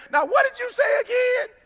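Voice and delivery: high-pitched mock pleading question; put-on high voice, poking fun; mock follow-up question. Imagined phone question to Warren Buffett.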